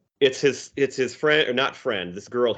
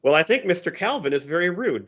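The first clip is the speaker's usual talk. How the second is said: higher, mock-haughty little girl; Susie's voice; 8 semitones higher, 3 dB louder than usual. Susie complaining about Calvin to the tiger, higher mock-haughty voice. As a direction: play Susie looking down on Calvin, pitched up.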